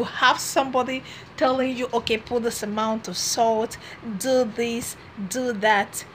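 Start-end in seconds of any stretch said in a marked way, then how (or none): none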